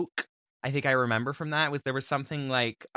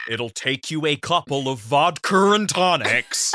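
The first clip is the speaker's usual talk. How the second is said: put-on British accent done right; 2 semitones higher, 5 dB louder than usual. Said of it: "he doesn't say it like that. He says".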